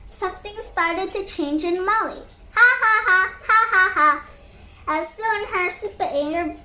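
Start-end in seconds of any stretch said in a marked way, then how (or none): 2.6-4.1 a burst of loud open laughter; laughing out loud. Acted. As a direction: laugh it out big, ha ha ha. Friends talked and laughed.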